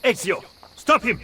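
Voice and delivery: loud, high call to stop a thief; urgent shout. Acted. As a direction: yell it across the square so Ezio hears. Urgent call as courier flees.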